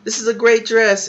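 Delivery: high-pitched mock indignation; mildly mad. Girlfriend defending her dress to her boyfriend, mildly mad. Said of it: "She gets mad".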